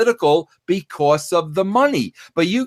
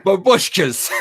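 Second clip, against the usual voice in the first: Babushkas said through a laugh; real laughter breaking into Babushkas; 4 semitones higher than usual. Real, not acted. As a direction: laugh the word out. Laughing at the German word.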